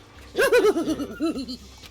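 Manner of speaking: loud gleeful villain laugh. Acted. Villain mocking the class, gleeful laughing. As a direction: cackle at the doomed students.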